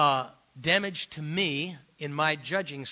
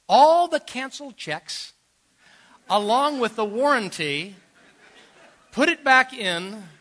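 building excitement; 7 semitones higher, 10 dB louder than usual. Real, not acted. Punchline build in story.

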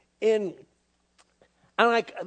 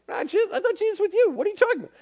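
higher, hurried disbelief; mock panic, pitched up and talking fast; alarmed parent; 14 semitones higher, 5 dB louder, much faster than usual. Parent to parent over the missing child, alarmed.

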